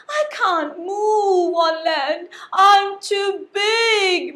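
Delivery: slow, moaning lament; slow sorrowful moan; moaning whale. Acted. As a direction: groan out the helplessness. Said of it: "moaned the whale".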